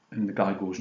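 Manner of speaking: ordinary talk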